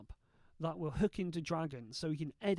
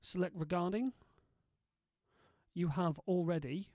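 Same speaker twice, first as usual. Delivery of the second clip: dictation commands. Shown as a demonstration. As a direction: dictate each word clearly to software. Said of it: "edit this with nothing more than my voice".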